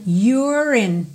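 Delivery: "You're in" with the R of "You're" running straight into "in"; linked R. Shown as a demonstration. Teacher linking "You're" to "in" for students. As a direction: link the R of "You're" to "in". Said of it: "vou ligar então o R direto no in".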